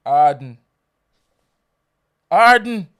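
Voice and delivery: "Harden" ending on N, no final D; demonstrating pronunciation. Shown as a demonstration. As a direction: insist loudly on "Harden" without D. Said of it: "What is this word?".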